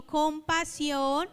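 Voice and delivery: "compasión" stretched slowly and firmly so the crowd can repeat it; drawn-out word. Shown as a demonstration. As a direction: say "compasión" very slowly with weight on each syllable. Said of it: "repitan conmigo". ("compasión" lento y marcado para que la congregación lo repita)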